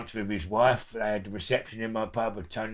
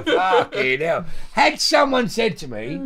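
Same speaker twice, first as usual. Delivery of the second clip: ordinary delivery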